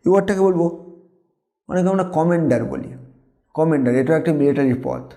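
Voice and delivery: ordinary talk, nothing marked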